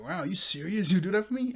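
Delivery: can't believe the favor; surprised gratitude. Retold or imitated. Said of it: "Or like".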